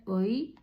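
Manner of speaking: اوی said "oy", opening on an o; ya joined after pesh. Shown as a demonstration. Teacher joining alef pesh to ya, اوی as "oy". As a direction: glide from short o into y on اوی. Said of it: "الف ما پیش داره".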